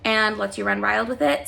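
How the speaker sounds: ordinary delivery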